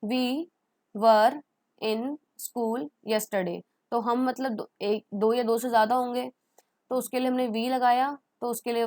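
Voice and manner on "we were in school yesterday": each word set apart with pauses; slow word by word. Shown as a demonstration. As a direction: dictate it slowly for students. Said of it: "तो हम उसको English में कैसे बोलेंगे?". (शब्द-शब्द रुककर धीरे बोलना)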